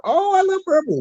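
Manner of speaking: high, gushing delight; a high, gushing "I love purple". Real, not acted. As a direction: squeal with delight over a colour.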